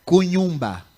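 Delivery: "Cunhumba" said loud, "ko-nyoom-ba"; word in Mozambican dialect. Shown as a demonstration. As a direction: sound out "Cunhumba" clearly. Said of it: "como é que se fala isso aí?". ("Cunhumba" pronunciado em voz alta)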